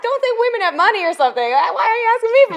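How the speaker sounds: high, loud, fast indignant rant; mock outrage at the homeless guy; complaining to the homeless guy, high and fast; exasperated complaint. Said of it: "And I'm like".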